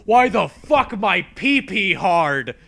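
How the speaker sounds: slow, loud, bewildered outburst; loud exclaim. Retold or imitated. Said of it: "and I go".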